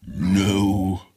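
hollow, final ghostly no; corpse refusing. Acted. Corpse refusing the offered shroud, ghostly. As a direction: refuse like a dead thing. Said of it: "replied the corpse".

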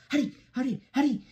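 high, hurried little engine; lightly panted urging. A narrator's reading. Percy panting to the trucks mid-race, lightly acted. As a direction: urge them on in a high, puffed voice. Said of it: "he panted to the trucks".

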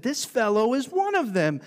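high, pointed accusing voice; accusing servant girl. Acted. Servant girl accusing Peter by the fire, high and pointed. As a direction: voice the servant girl calling him out.

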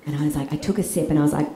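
ordinary talk

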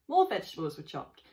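usual speaking voice

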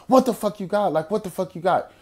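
high-pitched, acted-out alarm; retold startled challenge; alarmed demand. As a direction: demand an answer, pitch jumping up. Acted alarm as man reaches pocket.

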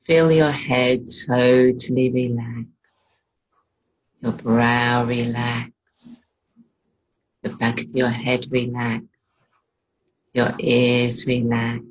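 slow, even meditation delivery with long pauses; guided meditation. Real, not acted. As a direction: ease the listener into relaxation, phrase by phrase.

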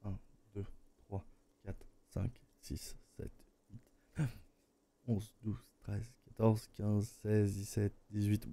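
very soft, low count; counting under breath. Real, not acted. Streamer timing the latency, counting under their breath. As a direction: murmur the numbers to yourself, evenly. Compte les secondes de latence.

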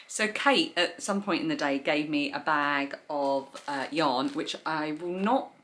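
usual speaking voice

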